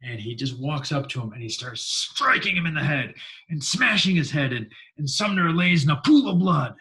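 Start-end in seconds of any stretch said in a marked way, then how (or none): 1.8-6.8 loud, rising dramatic narration; heated storytelling, pitched up; vivid violent retelling. Retold or imitated. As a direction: hammer the blows as you tell it. Acting out a violent beating.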